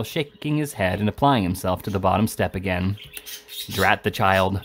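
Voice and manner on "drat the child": usual speaking voice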